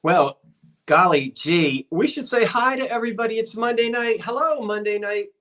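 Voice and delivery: normal talking voice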